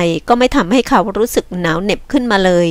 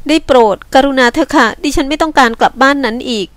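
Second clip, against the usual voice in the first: higher-pitched woman, pleading; 6 semitones higher than usual. Acted. Woman begs not to go back home, pleading. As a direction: beg him in a raised pitch.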